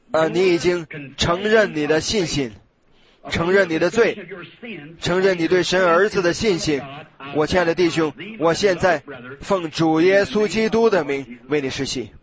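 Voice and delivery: slow, solemn pastoral address; solemn baptism formula. Acted. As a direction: speak the rite gently and slowly. Baptism formula spoken to convert.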